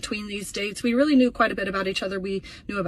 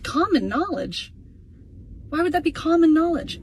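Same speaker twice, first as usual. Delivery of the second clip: incredulous retort; 7 semitones higher, 3 dB louder than usual. Retold or imitated. Repeating his excuse back to the date, outraged. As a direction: stretch it out, then blow up in disbelief. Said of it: "I said".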